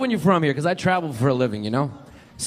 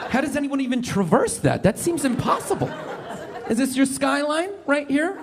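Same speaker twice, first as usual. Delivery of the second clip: mock impressed; 9 semitones higher than usual. Comic pretending to admire a small city, mock amazed. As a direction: fake wonder, rising questions. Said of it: "I'm like".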